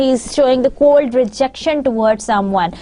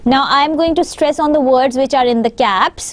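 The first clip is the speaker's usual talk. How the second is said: normal talking voice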